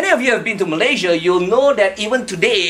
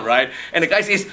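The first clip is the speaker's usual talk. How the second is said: normal talking voice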